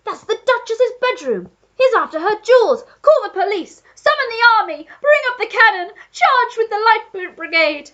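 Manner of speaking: put-on high, loud blustering old Duke in alarm; frantic old Duke shouting for help; Duke crying out. A narrator's reading. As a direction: rattle off orders like a panicking aristocrat. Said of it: "cried the Duke".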